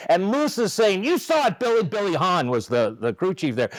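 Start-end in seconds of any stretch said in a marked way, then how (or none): 1.0-1.8 high-pitched mock protest; high, insistent complaint, acted out; retold indignant appeal. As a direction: imitate a manager appealing to the crew chief. Manager appealing to crew chief, acted.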